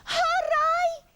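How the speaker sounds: high, very loud whoop; Iggy shouting joyfully. A narrator's reading. Iggy losing his itch, jubilant shout. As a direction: shout it out in joy. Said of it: "he shouted".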